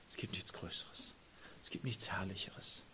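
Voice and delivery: whispered, hushed and intimate; awed whisper. Real, not acted. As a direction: breathe it like a secret of awe. Intimate awe in sermon.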